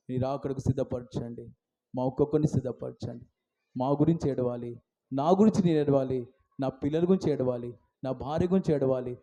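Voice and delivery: low, softened pleading; hushed, earnest petitions; subdued prayer. Real, not acted. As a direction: let the prayer sink into quiet supplication.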